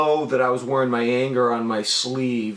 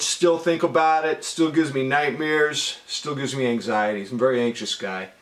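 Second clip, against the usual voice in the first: normal talking voice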